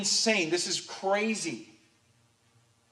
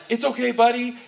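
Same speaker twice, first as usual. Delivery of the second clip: put-on high, raised baby-talk reassurance; soothing a baby; 6 semitones higher, 5 dB louder than usual. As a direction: comfort a crying newborn in a higher, louder voice. Soothing his crying newborn.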